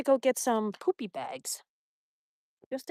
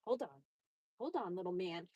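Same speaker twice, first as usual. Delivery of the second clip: soft to dog; 5 semitones lower, 14 dB quieter, faster than usual. Real, not acted. Talking softly to dog.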